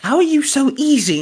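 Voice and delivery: high-pitched baffled question; incredulous. Retold or imitated. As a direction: act out amazement at his calm. Retelling disbelief at calm friend.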